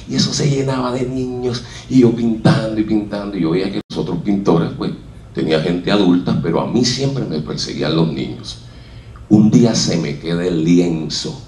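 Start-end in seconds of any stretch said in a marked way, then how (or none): none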